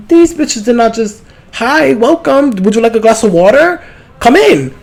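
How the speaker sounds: mock the social workers with exaggerated politeness; sweetly sarcastic, playing the hostess they skipped; sarcastic mock welcome. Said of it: "I said".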